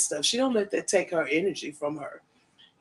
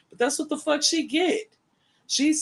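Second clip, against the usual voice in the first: high gloating; 10 semitones higher than usual. Real, not acted. Gloating about rival.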